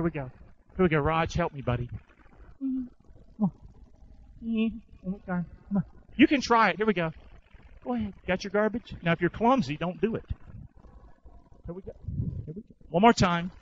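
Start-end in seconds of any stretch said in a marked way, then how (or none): none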